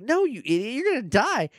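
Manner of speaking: high, exasperated mock yell.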